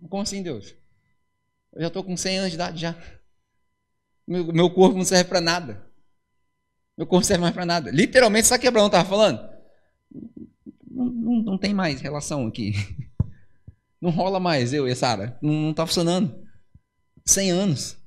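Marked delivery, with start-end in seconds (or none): 0.1-7.9 put-on softer, weary complaint of a hundred-year-old; a hint of a tired old man; lightly voiced old Abraão. 10.9-18.0 put-on lower, softer, resigned old man; a hint of a worn-out husband; lightly voiced old Abraão. Abraão complains, low voice.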